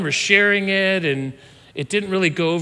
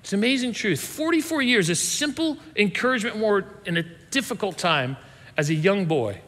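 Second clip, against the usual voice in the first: usual speaking voice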